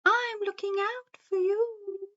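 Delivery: high, hooting sing-song owl. A narrator's reading. Wise owl warns the forest creatures, high hooting voice. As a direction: say it as a long high hoot. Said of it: "He blinked his big round eyes and said".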